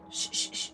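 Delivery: three short unvoiced shushes; quick "shh" bursts; repeated shushing. Real, not acted. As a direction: hush a noisy dog. Shushing the dog.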